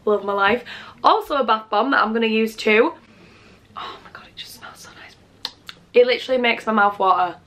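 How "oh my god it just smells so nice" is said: breathless unvoiced whisper. Real, not acted. Vlogger sniffing a bath bomb, awed whisper. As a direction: whisper it, savouring the smell.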